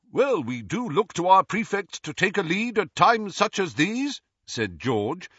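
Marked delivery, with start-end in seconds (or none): 0.1-4.2 high, self-important mimicry; mock a prig's stately speechifying; grandly pompous, pitched up; Percy, pompous. A narrator's reading. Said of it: "good imitation of Percy's most pompous manner".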